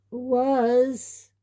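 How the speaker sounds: usual speaking voice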